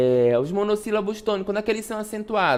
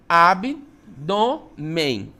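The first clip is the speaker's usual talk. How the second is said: "abdômen" slowly in separate chunks, ab-dô-men; drawn-out, spaced syllables in "abdômen"; split into syllables; much slower than usual. Shown as a demonstration. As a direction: pull "abdômen" apart for the class. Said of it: "como é que eu separo?".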